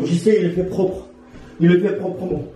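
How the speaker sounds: ordinary delivery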